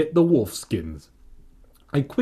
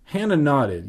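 ordinary delivery

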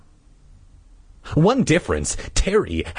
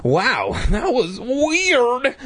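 murmur; 14 semitones higher, much slower than usual. Acted. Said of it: "I murmured".

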